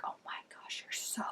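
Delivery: breathless, hushed gush over him; swooning whisper. Retold or imitated. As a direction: whisper it like you're smitten. Swooning girl in retold scene.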